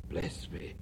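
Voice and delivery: breathless whisper of wonder. Acted. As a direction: whisper it in awe.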